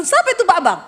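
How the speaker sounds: lightly acted husband's outburst; mock jealous husband. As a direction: burst in with a mock accusing question. Acted jealous husband interrupts wife's prayer.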